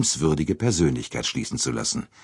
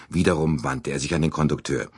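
usual speaking voice

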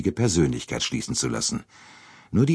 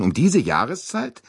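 high, incredulous question; disbelieving exclamation with lifted pitch; astonished interjection; 12 semitones higher, 3 dB louder than usual. A narrator's reading. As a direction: cut in, pitch leaping up. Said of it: "rief Monsieur Bouc dazwischen".

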